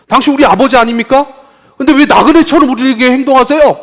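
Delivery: high, loud wounded protest; indignant reproach. Acted. People reproaching God as their father, loud and indignant. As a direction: demand an answer from God.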